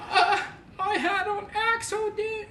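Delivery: put-on high, slow, timid child voice; imitating a little kid. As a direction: squeak it out like a guilty little boy. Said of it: "he's like".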